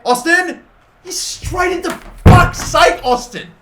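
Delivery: high, loud yell at the striker; frustrated yell. Real, not acted. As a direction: scream the name as the shot goes wrong. Striker blows a chance.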